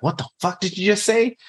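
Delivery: high, sharp mock outburst; imitating father's anger. Stepfather imitated snapping at him for cursing, angry. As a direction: snap back at the cursing kid. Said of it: "I'd be like".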